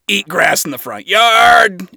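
shouted cruel joke; loud mocking voice. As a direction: jeer loudly at the prisoner. Adam mocking captive.

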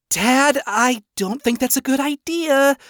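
high, anxious daughter voice; high-pitched, uneasy pleading; worried protest. A narrator's reading. Charlie tries to dissuade her father.

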